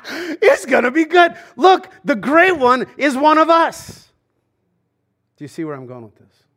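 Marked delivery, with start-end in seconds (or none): 0.1-4.1 much higher and louder, shouting with joy; ecstatic fan shout. Retold or imitated. Pastor voicing hockey fans cheering the Great One, ecstatic shout. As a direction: yell it like your team just won the cup. Fans thrilled Gretzky joined the Kings.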